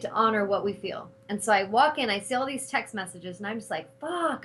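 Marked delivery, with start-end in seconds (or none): 4.0-4.4 a higher, mock exasperated swear; retold, half-voiced anger; pissed-off curse. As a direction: curse under the breath. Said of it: "I was so pissed".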